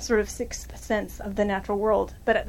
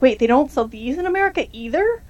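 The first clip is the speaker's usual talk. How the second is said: mild mock disbelief, rising at the end; surprised quote; 3 semitones higher than usual. Locals react to learning these insects are unique, surprised. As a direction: act out their puzzled surprise.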